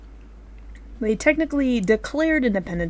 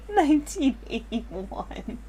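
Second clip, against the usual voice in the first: laughing; 6 semitones higher, 3 dB quieter, much slower than usual. Real, not acted. Laughing mock question.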